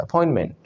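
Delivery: अपॉइंटमेंट as "a-poin-men"; silent final T. Shown as a demonstration. Teacher drilling the class, no final T in अपॉइंटमेंट. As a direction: let अपॉइंटमेंट end on the N. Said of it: "last वाला टी वैसे भी नहीं".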